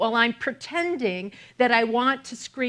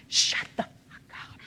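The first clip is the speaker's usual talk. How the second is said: retold whispered curse, softer; 7 dB quieter than usual. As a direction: hiss the thought under your breath. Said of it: "What I'm really thinking is".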